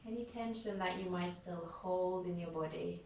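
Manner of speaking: unhurried, calm yoga nidra voice with long pauses; guided meditation. Real, not acted. Leads a guided relaxation.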